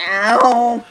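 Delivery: loud mock yelp of pain; imitated ow. Explaining how they'd react to pain, loud mock yelp. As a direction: yelp it out as a demonstration. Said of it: "I would have just been like".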